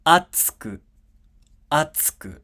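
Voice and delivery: "atsuku" as high "a", airy "ts", then "ku"; "atsuku" peaking on a, tsu whispered away; unvoiced tsu. Shown as a demonstration. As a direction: say "atsuku" with no vowel after ts. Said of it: "And you get".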